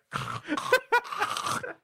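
a high, far, strangled shriek; the cry of a spirit taking hold; possessed shriek. Retold or imitated. Spirit cries out as Derek gets possessed, shrieking.

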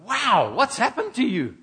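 high, bright amazed exclamation; a mock-amazed gasp of wonder; amazed wow. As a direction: sound struck by the change in them. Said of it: "people you meet go".

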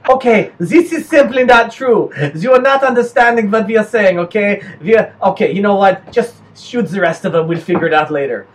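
put-on comic German accent losing patience; protest, then give up, in German accent; exasperated German accent. Misunderstood Germans giving up on Belgians, exasperated German accent.

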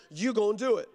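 usual speaking voice

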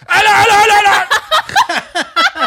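high, loud, laughing imitation of her; restaurant woman voice. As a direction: squeal it out like the flustered woman.

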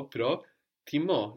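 Προτιμώ said slowly, syllable by syllable, stress on the last syllable; slow demo. Shown as a demonstration. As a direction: draw out Προτιμώ so the τιμή part stands out. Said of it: "like τιμή".

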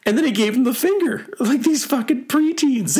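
high-pitched, amused outrage; amused disbelief. Real, not acted. Telling of fight with preteens, amused high voice. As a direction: tell it high, on the edge of laughter.

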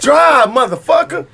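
high, loud, slow barked order; mock yell. Quoting the boss to friends, slow loud mock yell.

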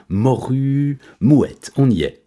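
excited page-scanning cry. A narrator's reading. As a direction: call out the found entry triumphantly. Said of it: "s'écria Je sais tout".

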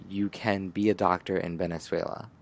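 ordinary talk, nothing marked

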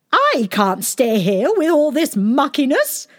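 high, loud fussy queen voice; haughty queen. A narrator's reading. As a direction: play a fussy, grand queen. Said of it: "said Queen Fussy".

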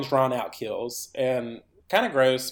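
ordinary delivery

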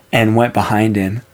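plain reading voice

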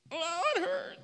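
high yelp of mock pain; hammed-up hurt cry; playful fake ouch for the kids. Acted toe-stepping skit.

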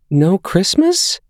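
put-on high, slow, hurt child voice; a five-year-old losing Christmas; dismayed little boy. A narrator's reading.

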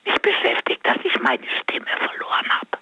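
whispering with a lost voice; strained whisper, no voice left; voiceless whisper. Real, not acted. Caller whispering to the radio host because her voice is gone.

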